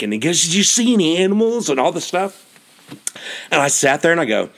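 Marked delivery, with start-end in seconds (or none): 0.4-2.5 imitating an eager, quick question; excited. Newcomer quizzing hiker about wildlife, excited. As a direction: fire the question off high and fast. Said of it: "He is so excited".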